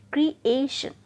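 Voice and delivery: ए in creation punched loud and drawn out; stress on second syllable. Shown as a demonstration. Teacher confirming the rule for learners, creation with a long, forceful ए. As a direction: hit and stretch the ए in creation. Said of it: "खींच कर बोल रहे हैं".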